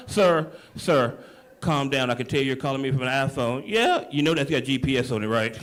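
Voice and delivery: low, disbelieving operator voice; a deep-voiced dispatcher who can't believe what he heard; 911 operator. Operator calming the caller.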